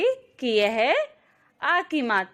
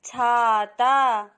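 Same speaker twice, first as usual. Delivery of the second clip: छाता drawn out very slowly, each आ held; very slow blend; 3 dB louder, much slower than usual. Shown as a demonstration. Teacher blending syllables for children, very slow. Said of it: "अब हम इनको मिलाकर बोलेंगे".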